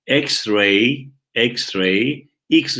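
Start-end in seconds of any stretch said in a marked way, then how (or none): none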